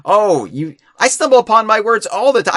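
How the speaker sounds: mock teasing. Acts out a mocking remark.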